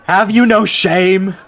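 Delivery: angry villager shouting; indignant shout. Acted. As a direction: shame them loudly. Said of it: "it's like".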